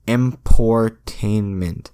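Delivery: "emportainment" stretched out slowly, each syllable drawn long; drawn-out made-up word. Shown as a demonstration. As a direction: sound out "emportainment" slowly, as if testing it. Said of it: "sounding out made-up word".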